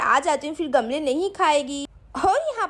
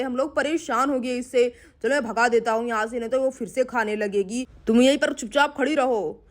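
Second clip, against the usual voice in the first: lower, grumbling man shooing a cow; grumpy man; 4 semitones lower than usual. Acted. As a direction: growl the annoyance low. Uncle shoos the cow.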